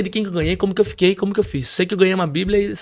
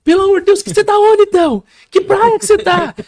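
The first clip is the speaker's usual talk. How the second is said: very high, loud demands; shrieked, exasperated high-pitched questions; mother's frantic shouting; 8 dB louder than usual. Retold or imitated. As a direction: imitate a panicked mother yelling. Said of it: "Aqueles gritos que ela dava".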